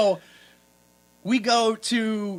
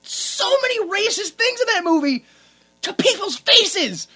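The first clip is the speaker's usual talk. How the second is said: high, loud, laughing disbelief; appalled amusement; 11 semitones higher, 5 dB louder than usual. Real, not acted. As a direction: cry out in scandalized glee. Appalled laughing rant about a film.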